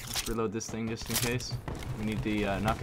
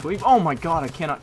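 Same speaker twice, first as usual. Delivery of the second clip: higher, louder panicked cry; frantic shout; 6 semitones higher, 10 dB louder than usual. Real, not acted. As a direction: panic mid-fight. Struggling in a fight.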